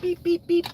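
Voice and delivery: high, steady mock beeping; sing-song beep like a reversing truck; high pitched beeper imitation; imitated backup beeper. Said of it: "the backup beeper is gonna start beeping".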